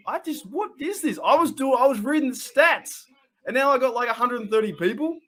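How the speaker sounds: high, incredulous babble; flustered and high, trying to take it in; real bewilderment. Real, not acted. Streamer baffled by the viewers pouring in, bewildered. Still stunned by the sudden audience.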